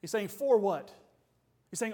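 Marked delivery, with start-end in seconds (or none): 0.3-0.9 disgusted exclamation. Retold or imitated. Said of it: "it's a show of disgust".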